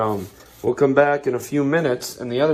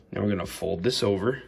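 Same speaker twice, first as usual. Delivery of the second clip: ordinary delivery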